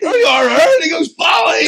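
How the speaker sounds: shouted high and loud; excited yell. Retold or imitated. A fan shouting as he recognises the DJ, high and loud.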